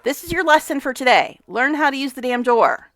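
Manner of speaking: loud, firm lecturing; fed-up telling-off; exasperated scolding. Real, not acted. Scolding Sim over the door.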